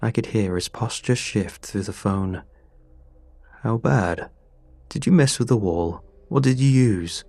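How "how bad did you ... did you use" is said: quick, louder questioning; pressing landlord. A narrator's reading. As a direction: demand answers, rising.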